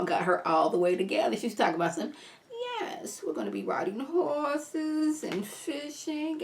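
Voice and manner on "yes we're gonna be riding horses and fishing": slow, higher, soft sly drawl; a grandma sweetly promising the country life; evil Sadidi Grandma voice. Acted. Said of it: "my evil Sadidi Grandma voice".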